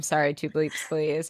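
normal talking voice

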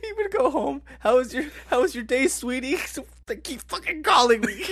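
high-pitched mock domestic chat, breaking into laughter; laughing retelling. Acting out James coming home to his sweetie, high and laughing. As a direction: imitate the harassed worker while cracking up.

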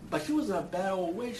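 usual speaking voice

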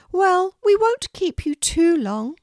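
a kindly Queen welcoming a guest, pitched well up. Acted. The Queen inviting a shy girl to tea, high regal voice.

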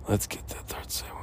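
unvoiced whisper. Shown as a demonstration. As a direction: breathe it out as a whisper. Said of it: "repeats it in a whisper".

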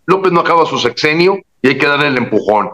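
ordinary talk, nothing marked

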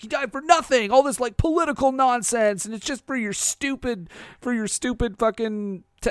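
high-pitched indignant outburst, acted; mock upset. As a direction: protest like a hammed-up grieving friend. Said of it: "he's upset 'cause".

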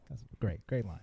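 ordinary delivery

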